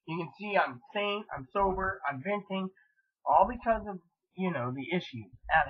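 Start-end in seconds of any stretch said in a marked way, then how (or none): none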